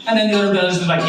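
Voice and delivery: ordinary delivery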